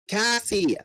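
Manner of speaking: the narrator shouts for a friend in danger; yelling. Acted. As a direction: call out her name urgently. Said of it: "I yelled".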